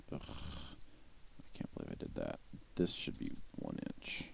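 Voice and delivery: muttered under the breath; annoyed ugh. Real, not acted. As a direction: grumble to yourself, annoyed. Muttering over own mistake.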